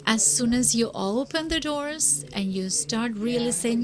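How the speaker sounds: ordinary talk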